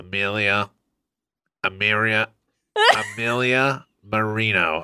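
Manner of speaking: slow, nose held shut; pinching nose. Shown as a demonstration. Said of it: "now I'm trying to say her name".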